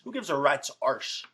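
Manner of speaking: "Who gives a rat's arse?" with "arse" in a mock British accent. Shown as a demonstration. Wrestler switching "ass" to a mock British "arse" for viewers.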